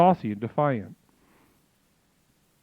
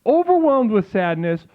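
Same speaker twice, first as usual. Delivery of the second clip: slow, higher, grandly dramatic preaching; mock solemn sermon; 7 semitones higher, slower than usual. Streamer preaching mock doom to chat, slow and grand. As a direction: drag out the doom like a prophet.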